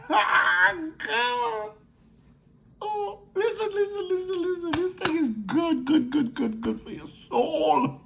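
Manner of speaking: high, soft, tearful pleading voice; anguished high cry, pleading; crying plea. Acted. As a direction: beg them to listen through tears. Voicing Yeshua pleading, crying out.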